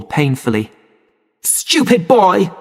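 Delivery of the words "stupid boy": higher, slow mock shout; angry shout. A narrator's reading. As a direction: snap at the boy in anger. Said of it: "she shouted".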